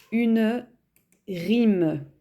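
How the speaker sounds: slow, spaced dictation; dictation pace. Shown as a demonstration. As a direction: say each word slowly for writing it down. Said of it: "dictating words to the class".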